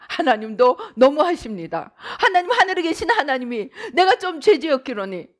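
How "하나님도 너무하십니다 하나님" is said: ordinary delivery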